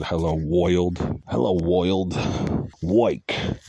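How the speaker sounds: put-on thick old-world New York gangster "oi" vowels; old New York gangster accent. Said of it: "old-fashioned New York gangster accent".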